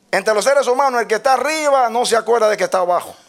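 high, loud declamation; preaching shout. Real, not acted. Preacher to the congregation on human forgetfulness, shouted. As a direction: drive it home at full voice, very high. Preacher driving a point home.

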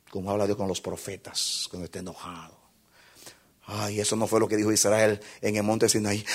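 low, soft intimate sermon voice; hushed preaching. Acted. Preacher talking softly to the congregation about God's voice. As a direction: draw them close, speaking low.

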